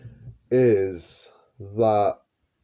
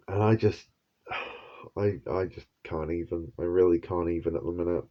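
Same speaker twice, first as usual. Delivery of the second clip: weary exasperation; 5 semitones lower, 8 dB quieter than usual. Real, not acted. Exasperated trailing off.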